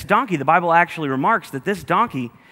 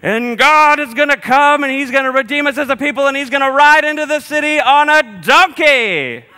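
mock prophet voice; 11 semitones higher, 7 dB louder than usual. Preacher voicing Zechariah to the crowd, high hammed-up proclaiming. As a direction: boom it out like a wide-eyed prophet. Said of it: "He's like".

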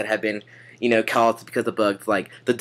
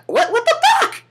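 squeaky falsetto disbelief, rising at the end; a comic high yelp of outrage; shocked; 5 dB louder than usual. Pretend. Shocked person facing the cicada.

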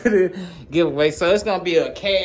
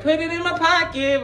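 greedy YouTube voice; 11 semitones higher than usual. Retold or imitated. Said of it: "YouTube like".

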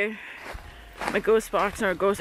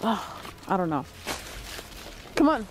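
ordinary delivery

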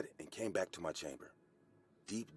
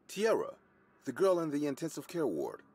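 insistent; 7 semitones higher, 4 dB louder than usual. A narrator's reading. Insisting to a doubtful receptionist.

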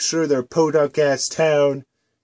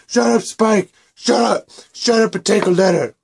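higher, louder furious yell; angry shout; 5 semitones higher, 3 dB louder than usual. Acted. Twilight ordering Spike to take a letter, angry shout. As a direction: snap orders at him.

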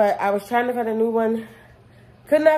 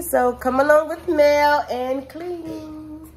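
higher, slow, drawn-out sign-on; sing-song vlog invite; 6 semitones higher, much slower than usual. Acted. Vlogger inviting viewers to clean along, slow sing-song. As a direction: stretch it out like a catchphrase invite.

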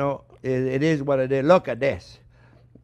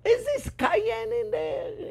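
high, slow, wondering question; curious guest; 4 dB quieter, much slower than usual. Retold or imitated. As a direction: ask it like a puzzled dinner guest. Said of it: "your guest should say".

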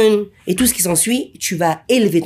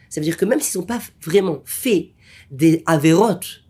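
normal talking voice

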